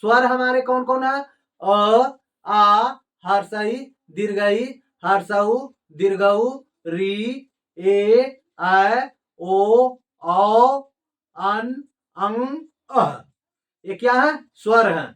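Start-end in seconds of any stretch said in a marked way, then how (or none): 1.6-13.2 loud, separated vowels from अ to अह; vowel recitation. Shown as a demonstration. Teacher listing the vowels for learners, loud deliberate recitation. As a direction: call out each vowel firmly, lengthened.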